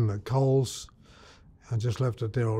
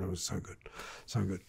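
hushed relish; 5 semitones lower, 12 dB quieter, faster than usual. Real, not acted. Remembering the bread.

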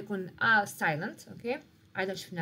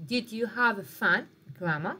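slow, louder model question; 5 dB louder, much slower than usual. Shown as a demonstration. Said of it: "مثال يقول لك الانتيروغاتيف".

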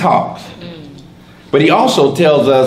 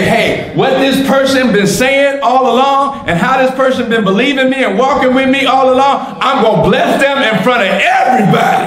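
voicing God; 9 semitones higher than usual. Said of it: "God will say".

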